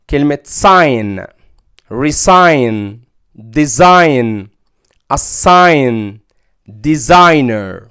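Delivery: sign, resign, design with silent g and ai; slow sign, then clear model words; teacher demo. Shown as a demonstration. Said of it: "حرف الجي هنا ما ينطق".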